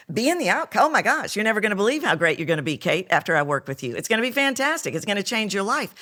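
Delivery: fast, high, loud salesy hype; hyped-up sales pitch. Retold or imitated. Mocking an entrepreneur pitching a client, hyped and fast. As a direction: gush like an overeager coach selling. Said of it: "We're taught".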